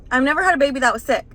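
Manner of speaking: retold panic, rapid and loud; flustered. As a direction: blurt it out in a rush. Frantic call to hotline.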